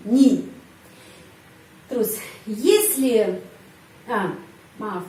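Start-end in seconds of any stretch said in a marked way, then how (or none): 0.1-0.5 "ni", the vowel said as a clear I; "ni" with I sound. Shown as a demonstration. Teacher showing that unstressed не is pronounced with I, "ni". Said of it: "Pengucapannya I".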